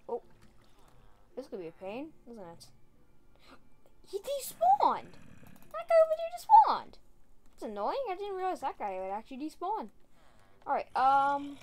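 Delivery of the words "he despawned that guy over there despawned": high, loud cry of disbelief; shocked shout. Real, not acted. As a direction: yell it in shock. Zombie despawns, shocked shout.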